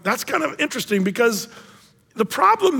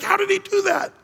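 high, amazed cry; amazed exclamation; 12 semitones higher than usual. Retold or imitated. A kid amazed at the finished painting, high. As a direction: marvel at the magic.

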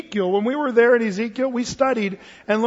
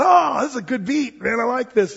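a touch higher, mildly imitating his dad; a hint of a cheerful dad; dad's voice; 5 semitones higher, 3 dB louder than usual. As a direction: lightly voice the easygoing dad. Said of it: "and he said".

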